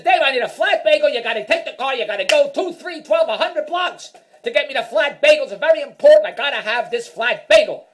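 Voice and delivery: husband mimics wife insisting he fetch a flat bagel, mock nagging; mock demanding wife. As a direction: ham up the wife pleading for her bagel.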